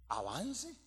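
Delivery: soft word with rising tune; quiet questioning. Retold or imitated. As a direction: echo the order softly as a question. Asks doubtfully after the objection.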